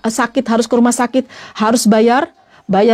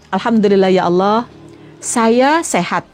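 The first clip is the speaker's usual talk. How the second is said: lower, slow, devout thanks to God; thankful prayer; 5 semitones lower, slower than usual. Shown as a demonstration. Preacher showing listeners how to thank God for health, low and slow.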